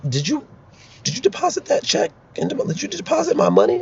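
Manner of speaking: high, sneaky little question; pretend advisor. Advisor asking candidate whether the check was deposited, high-pitched mock innocence. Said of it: "I'd be like".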